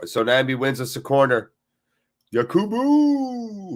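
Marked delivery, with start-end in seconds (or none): 2.3-3.7 high, stretched-out call of the name. Real, not acted. Fan calling out the player's name, high and drawn out. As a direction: hail the player across the pitch.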